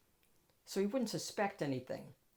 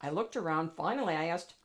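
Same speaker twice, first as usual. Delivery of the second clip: plain reading voice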